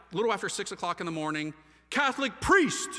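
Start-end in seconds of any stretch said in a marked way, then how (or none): 1.9-2.9 loud, high, drawn-out holler; yelled out. Retold or imitated. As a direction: holler it across a whole office. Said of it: "So I yelled out".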